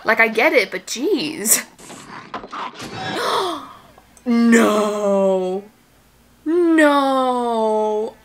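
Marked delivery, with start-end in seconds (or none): 1.9-3.6 soft, sharp intake of breath; horrified gasp. Real, not acted. Shocked by what happens on screen. 4.3-8.2 long, drawn-out "no"; horrified disbelief. Real, not acted. Horrified reaction to the film.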